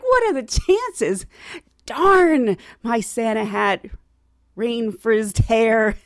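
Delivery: drawn-out comic lament, loud; exasperated, each word stretched out; mock dismay. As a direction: curse your luck in slow, stretched words. Acted dismay at her hair.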